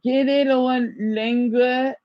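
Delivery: slow, halting French; repeating French. Shown as a demonstration. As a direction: echo the French slowly. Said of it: "repeating French aloud".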